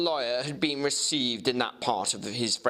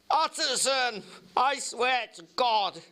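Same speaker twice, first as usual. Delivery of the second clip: higher, anguished Jekyll voice; doctor crying out; 12 semitones higher than usual. Acted. Jekyll swearing to his friend, raised high desperate voice. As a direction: plead high and fervent, voice strained. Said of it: "cried the doctor".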